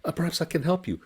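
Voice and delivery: high, gentle pleading merchant; kind merchant. Acted. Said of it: "He says".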